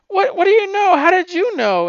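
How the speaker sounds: very high, loud mock shock; loud, high-pitched imitation of amazement; acted astonishment. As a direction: blurt it out as a stunned character. Voices niece-in-law's astonished reaction.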